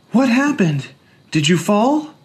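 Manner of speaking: high, worried woman's voice; anxious high question to a child; alarmed mother. A narrator's reading. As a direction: rush to the hurt child. Madeline alarmed.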